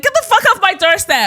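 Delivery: high, loud mimicked shout; imitated angry shouting. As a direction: order trespassers away like an outraged homeowner. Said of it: "proper shouting in this quiet area".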